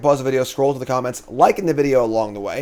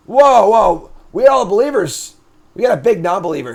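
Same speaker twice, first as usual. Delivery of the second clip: excited whoa; 7 semitones higher, 6 dB louder than usual. Real, not acted. Reacting to chat votes.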